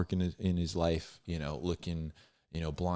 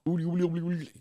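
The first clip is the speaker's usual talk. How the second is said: higher, louder babbling nonsense syllables; mock speaking in tongues; 11 semitones higher, 4 dB louder, much faster than usual. Mocking a wild preacher barging into church, babbling nonsense. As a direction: rattle off oodly, oodly like ecstatic tongues. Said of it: "hooting, hollering, speaking".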